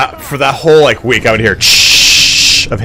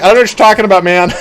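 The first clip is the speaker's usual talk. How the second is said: mock innocent denial; 8 semitones higher, 6 dB louder, much faster than usual. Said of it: "I was like".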